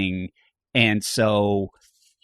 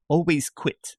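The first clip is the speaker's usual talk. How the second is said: quoting advice; 6 semitones higher, slower than usual. Retold or imitated. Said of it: "the great advice from Phil Rosenthal".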